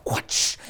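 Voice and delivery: voiceless high-five smack; imitated slap noise. Speaker high-fiving frustration, imitated slap sound. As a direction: make a whooshing slap with your mouth.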